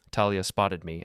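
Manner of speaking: plain reading voice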